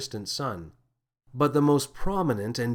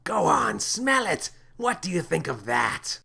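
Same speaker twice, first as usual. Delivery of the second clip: alien captain, breathy then pushing higher; pushy captain; 4 semitones higher than usual. Acted. Captain pushing Thlaley to smell his breath, insistent. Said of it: "exhaled loudly and said".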